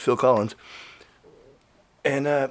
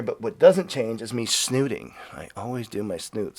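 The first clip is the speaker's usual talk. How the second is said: ordinary delivery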